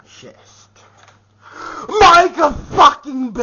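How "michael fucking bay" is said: shouts the name out loud and high; angry shout. Real, not acted. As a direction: bellow the name like an accusation. Ranting vlogger calls out his target.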